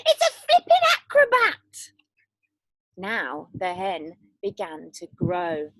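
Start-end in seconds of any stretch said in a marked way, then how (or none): 0.1-1.9 very high, loud call; old woman shouting from the roof; delighted shout. Acted. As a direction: cry out in wonder from afar. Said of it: "Grandma shouted from the rooftop".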